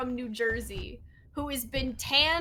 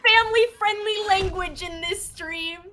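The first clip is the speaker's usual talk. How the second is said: mock-stern Jersey mom telling off, higher and louder; mock scolding; 6 semitones higher, 9 dB louder than usual. Jersey mom chewing out her co-caster over his language, mock scolding. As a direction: scold him like an outraged mom. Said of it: "while I was, like, chewing you out".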